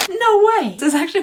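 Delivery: high, loud delighted cry; thrilled by the sponge; excited. Real, not acted. Favorite sponge revealed.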